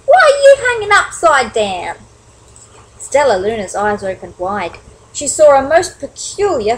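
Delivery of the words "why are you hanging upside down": high, loud challenge; raised, incredulous shout. Acted. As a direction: call the question out loudly. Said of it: "a loud voice said".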